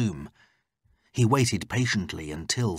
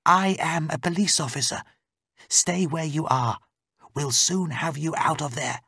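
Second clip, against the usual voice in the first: high, steady female character voice; raised voice of a confident rescuer; reassuring policewoman; 8 semitones higher than usual. Acted. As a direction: take charge and reassure her.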